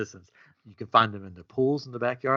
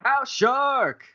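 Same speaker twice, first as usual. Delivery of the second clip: dramatic high title; 14 semitones higher than usual. Pretend.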